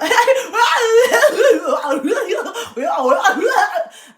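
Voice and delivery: loud high screaming laugh; laugh-cry shriek. Real, not acted. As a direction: howl with laughter. Huge reaction.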